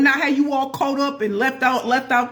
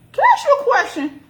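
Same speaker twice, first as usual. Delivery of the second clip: put-on older woman; 4 dB louder than usual. Older woman voiced in story.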